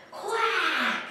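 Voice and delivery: high, long falling quack; eager duck quack. Acted. Duck spots the go-kart track, eager high quack.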